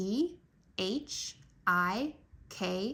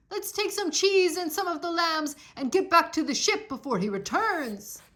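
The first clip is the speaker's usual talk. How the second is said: a touch of fear, higher and hurried; frightened urging; 7 semitones higher than usual. A narrator's reading. Scared sailors urging escape before the giant returns, anxious. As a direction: urge the others to flee, anxious. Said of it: "Odysseus's men were terrified".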